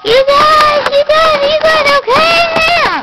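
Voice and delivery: high, loud shouted call. Pretend. Toy calling out to its fallen friends, loud and high. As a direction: yell across the room to check on them.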